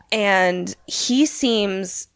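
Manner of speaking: usual speaking voice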